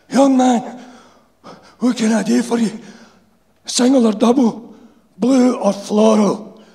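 high, loud wheezing Glaswegian; wheezy old insulator. A narrator's reading. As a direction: wheeze it like a sick old worker. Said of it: "wheezed the insulator".

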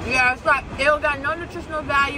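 speaking with burger at mouth; words muffled by mouthful; talking while biting food. Real, not acted. Seen 2: Talks holding burger, then bites into it during final words.

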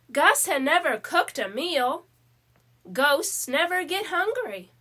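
ordinary delivery, nothing marked

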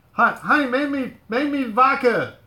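high, loud call to the next room; calling out to wife. Real, not acted. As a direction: holler for honey to fix a drink.

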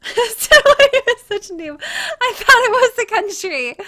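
real embarrassed laughter; laughing. Real, not acted. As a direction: blurt it out through helpless laughter. Laughing at own map mistake.

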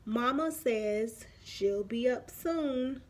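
usual speaking voice

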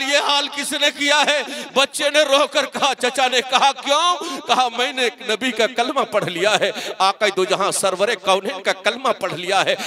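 loud, high emotional storytelling; full-force sermon narration; impassioned preaching. Acted. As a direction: raise the voice to tell the reunion with fervour.

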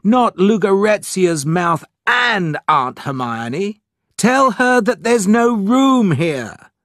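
high, slow, emphatic objection; exasperated protest. A narrator's reading. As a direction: refuse the guest, stressing each word. Larry protesting at aunt's visit.

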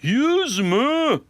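can't believe the number; incredulous yelp; shocked disbelief. Acted. Dad shocked at hundred push-ups.

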